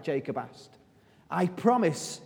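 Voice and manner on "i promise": usual speaking voice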